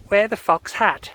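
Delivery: high-pitched, slow mum quote; mum's punchline voice. Acted.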